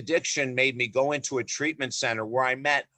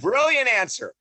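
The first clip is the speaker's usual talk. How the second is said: high, loud mock congratulation; hammed-up praise; 10 semitones higher, 5 dB louder than usual. Father Ron applauding a patient's answer, hammed-up delight.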